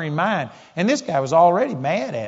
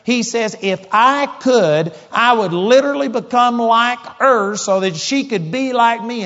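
violent anger; 4 semitones higher than usual. Retold or imitated. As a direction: hammer each promise home, furious. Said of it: "he just was so violent".

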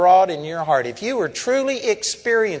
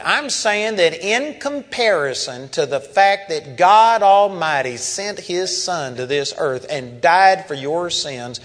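usual speaking voice

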